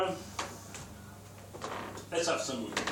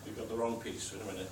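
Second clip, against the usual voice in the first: ordinary talk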